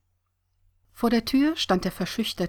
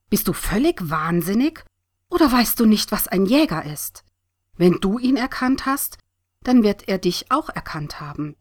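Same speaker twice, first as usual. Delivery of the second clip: acted heated scolding, pitched up and louder; ranting; 4 semitones higher, 6 dB louder than usual. A narrator's reading. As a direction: lay into your friend in alarmed outrage. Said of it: "wetterte sie".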